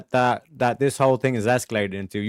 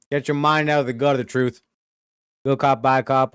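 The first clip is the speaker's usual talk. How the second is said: ordinary delivery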